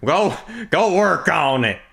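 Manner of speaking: high, heckling Texan voice; Texan accent. Pretend. Texan character heckles the Canadian.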